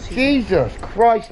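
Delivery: high, drawn-out startled exclamation; jolted by a sudden scare. Real, not acted. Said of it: "it fucking genuinely made me jump".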